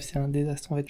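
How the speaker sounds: usual speaking voice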